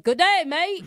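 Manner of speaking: loud, high mock Australian accent. Caller imitating the groom's secret Australian accent, loud Australian accent. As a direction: belt it out as an exaggerated Aussie. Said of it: "talking in an Australian accent".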